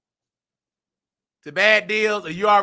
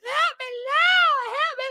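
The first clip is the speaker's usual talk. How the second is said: mock crying wail. Mocking [name] crying at the funeral with a high wail. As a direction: wail it like an overdone mourner.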